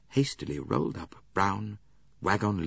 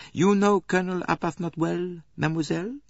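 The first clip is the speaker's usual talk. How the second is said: put-on French-accented detective, polite and fussy; lightly voiced Belgian detective; Poirot voice; 10 semitones higher than usual. A narrator's reading. Poirot questions Mary.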